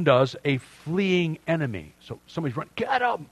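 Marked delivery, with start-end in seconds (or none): 2.7-3.3 pursuer's shout. Pretend. Pursuers chasing fleeing enemy.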